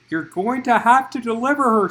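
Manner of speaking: loud, high shout; urgent loud shout. Acted. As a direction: shout it at her in labour. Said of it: "shouted the pregnant woman".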